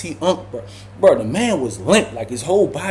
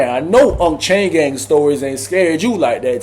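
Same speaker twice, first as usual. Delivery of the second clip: ordinary delivery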